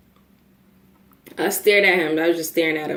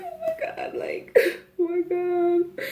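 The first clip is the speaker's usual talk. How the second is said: high, soft whimper of panic; mock crying; 11 semitones higher, 6 dB quieter, slower than usual. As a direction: squeak it out through mock sobs. Reliving panic with racing heart.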